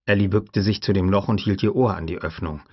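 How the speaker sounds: usual speaking voice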